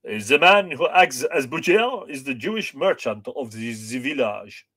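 hammed-up French accent; badly faked French accent, played for fun. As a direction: put on a mock French accent. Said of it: "He says in a very French accent".

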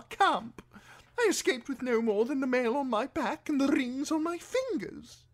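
high-pitched fussy highborn lord; Lord Alistair. A narrator's reading. Imprisoned lord excuses his appearance to Davos, fussy. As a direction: apologise in a high aristocratic voice.

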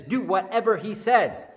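loud, firm motherly order; rally the servants with a firm order; a mother taking charge of the crisis; loud command. Acted. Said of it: "gathers the servants together and says".

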